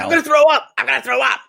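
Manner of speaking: Stacey reacting to the videos; sick Stacey. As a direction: sound about to vomit, high and loud. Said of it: "playing the role of Stacey".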